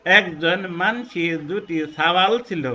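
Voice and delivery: slow Jessore-Khulna dialect line; teacher showing the Jessore-Khulna way of speaking. Shown as a demonstration. যশোর-খুলনার আঞ্চলিক ভঙ্গিতে ধীরে. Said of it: "এটা যশোর বা খুলনা অঞ্চলের".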